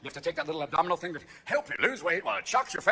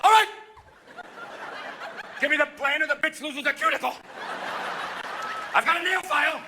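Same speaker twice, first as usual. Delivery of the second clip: hammed-up, high-pitched menace; mock hijacker; 7 semitones higher than usual. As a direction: shout demands like a puny hijacker. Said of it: "Are they afraid you're gonna go".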